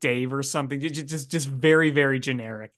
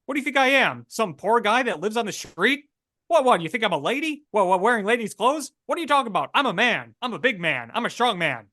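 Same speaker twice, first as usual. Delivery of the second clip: put-on higher, pushy old-movie Transatlantic tough-guy accent; 1950s Transatlantic accent; 7 semitones higher than usual. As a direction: play a 1950s Transatlantic-accent spy who insists he isn't one, fast and defensive. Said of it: "And thus a new character is born".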